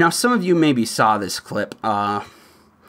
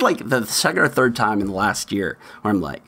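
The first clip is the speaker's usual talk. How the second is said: normal talking voice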